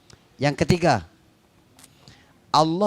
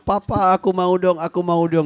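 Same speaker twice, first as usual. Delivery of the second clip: put-on higher and louder, a child whining for a snack; loud child begging; 4 semitones higher, 5 dB louder than usual. Child begging papa loudly at the shop for a snack. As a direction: beg like a small child pestering papa. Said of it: "Dia ngomong kenceng-kenceng".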